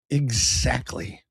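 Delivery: "Exactly" stretched very slowly; drawn-out agreement. Real, not acted. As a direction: savour every syllable of "Exactly". Agrees, then repeats it normally.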